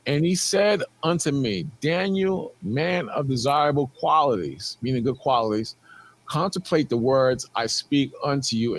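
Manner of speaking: slow, low solemn reading; scripture reading. Acted. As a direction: read the verse slowly and gravely. Reads scripture aloud.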